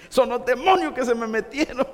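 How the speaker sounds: comic flustered lady; frantic excuse. Acted. Caught lady blaming demons before her pastor, comic pleading.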